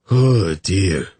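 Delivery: mild drawn-out moan of regret; a touch of groaning dismay. A narrator's reading. Hungover man learning he sang, mild moan. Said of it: "he moaned".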